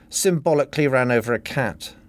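usual speaking voice

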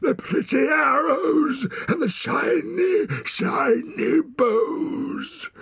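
high, loud, slow taunt; angry taunting. A narrator's reading. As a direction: jeer wildly, stretching out the words. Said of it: "that only made him angrier".

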